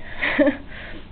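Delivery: breathy laugh. Real, not acted. Asks viewers not to judge.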